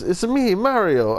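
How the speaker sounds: high-pitched Italian game-hero catchphrase; Mario. Acted. Player joking about Ezio's uncle, Mario impression. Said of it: "Oh no, that's Mario".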